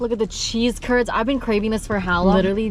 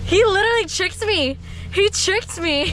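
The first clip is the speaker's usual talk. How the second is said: mock outrage; 10 semitones higher than usual. Real, not acted. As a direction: play up being tricked. Outraged at boyfriend's trick.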